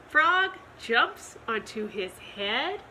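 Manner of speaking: usual speaking voice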